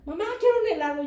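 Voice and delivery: put-on high, loud child's plea; pleading daughter. Daughter asking mother for ice cream, loud high begging. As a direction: whine it out like a daughter wanting a treat.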